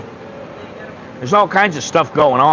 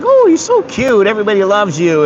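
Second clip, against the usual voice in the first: put-on high-pitched cooing over a child; imitated gushing adult; 6 semitones higher than usual. People admiring a little kid, imitated gushing.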